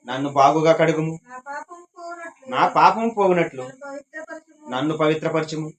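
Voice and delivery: unhurried Bible reading, phrase by phrase; solemn scripture reading. Acted. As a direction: read each phrase like a prayer. Reads Psalm 51 aloud.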